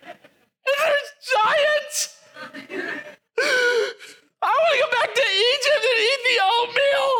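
hammed-up sob for Egypt; weeping man in the back wailing for oatmeal; mock weeping. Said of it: "one dude in the back weeping".

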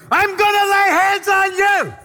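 high, loud and slow shout. Retold or imitated. Preacher shouting in the next lady's ear. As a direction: bellow it over the noise. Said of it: "now I'm shouting at people".

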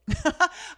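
a sudden loud high laugh; burst of laughter. Real, not acted. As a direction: break into a real laugh at herself. Laughs at her own reaction.

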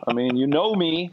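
whiny protest. Retold or imitated. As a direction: whine like you've been embarrassed. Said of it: "I'm like".

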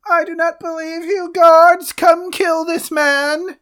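very high, loud, put-on old-world cry; peasant calling guards. Man from the past calls the guards on the traveler, very high and loud. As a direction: summon the guards in outrage. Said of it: "I'm the one that has to do the accent".

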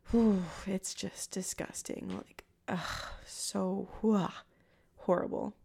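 real disgust, soft and slow with groans; hushed, repulsed reaction. Real, not acted. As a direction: recoil with an exhaled 'whew' and 'ugh'.

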